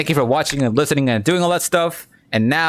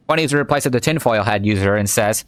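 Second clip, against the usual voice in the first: ordinary delivery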